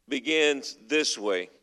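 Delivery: ordinary talk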